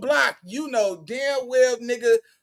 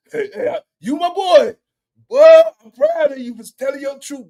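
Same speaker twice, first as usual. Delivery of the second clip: hyped praise; 7 semitones higher, 9 dB louder than usual. Real, not acted.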